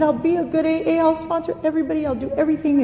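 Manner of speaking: high-pitched, frantic retold bargaining; hysterical pleading. Newcomer promising her sponsor to be good, hysterical. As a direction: plead in a high, hammed-up desperate voice. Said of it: "like, hysterical".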